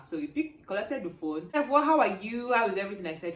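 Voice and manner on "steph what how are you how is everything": loud, higher, acted friendly hello; hearty greeting. Examiner greets student he knows, heartily. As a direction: imitate a cheerful phone greeting.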